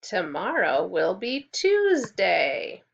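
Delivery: slow, clear repeat-after-me; slow model sentence. Shown as a demonstration. Teacher models tomorrow's day slowly for the class. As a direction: stretch it out for young learners. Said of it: "Can you repeat after me?".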